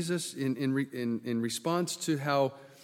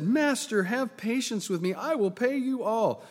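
higher-pitched, mildly acted begging; earnest plea, pitched up; pleading servant; 7 semitones higher than usual. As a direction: beg the master with a raised pitch. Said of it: "fell down before him and said".

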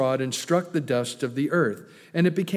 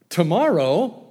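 incredulous; 2 semitones higher, 5 dB louder than usual. Real, not acted. Preacher mocking Pharaoh's strange choice, incredulous. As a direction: throw "Tomorrow?" back in disbelief.

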